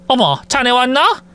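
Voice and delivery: lightly voiced surprised mother-in-law; a hint of an old mother caught off guard. Mother-in-law greets son-in-law.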